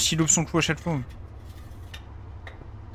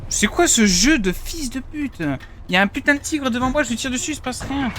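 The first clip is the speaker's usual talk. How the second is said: raging gamer; 8 semitones higher than usual. Real, not acted. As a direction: vent fury at the game. Gamer raging at invincible tank.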